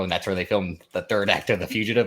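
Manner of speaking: ordinary talk, nothing marked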